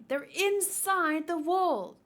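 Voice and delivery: slow, loud, stress on inside; dramatic reveal. Acted. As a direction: reveal it slowly. Dramatic revelation.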